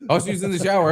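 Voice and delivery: normal talking voice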